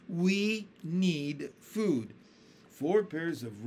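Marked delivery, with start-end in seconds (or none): 0.1-2.1 very slow, changed centipede voice; centipede demands. A narrator's reading. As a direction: lay it down slowly. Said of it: "he said".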